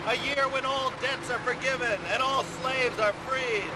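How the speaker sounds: ordinary talk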